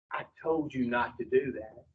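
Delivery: low, hushed reproach; a soft, low I-told-you-so from inside the head; returning inner voice. Acted. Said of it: "come back a little louder a second time".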